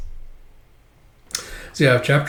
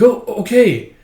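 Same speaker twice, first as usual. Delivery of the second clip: meek surprised reply; 9 semitones higher, 5 dB louder than usual. Acted. Boy dragged off, meek.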